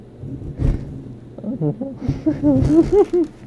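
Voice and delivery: high, loud laughing talk; embarrassed laughing apology; laughing loudly. Real, not acted. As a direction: talk through a laughing fit. Laughing through loud mistake.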